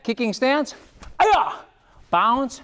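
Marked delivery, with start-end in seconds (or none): 1.2-1.5 high, sharp battle cry; kiai shout. Shown as a demonstration. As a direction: snap out a fighting yell. Kiai at the start of sparring.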